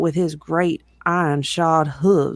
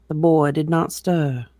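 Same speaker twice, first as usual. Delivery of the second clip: usual speaking voice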